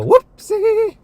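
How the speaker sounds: squeaky, very high cartoon whoopsie. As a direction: squeal it like a toy horn. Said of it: "my horn will go".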